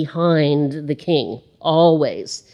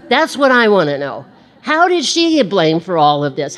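louder, higher, indignant questioning; mock outrage; 5 semitones higher, 5 dB louder than usual. Real, not acted. Pastor defending mothers, loud mock indignation. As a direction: rise up in playful indignation.

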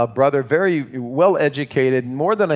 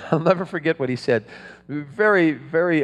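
usual speaking voice

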